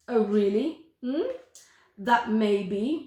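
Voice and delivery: plain reading voice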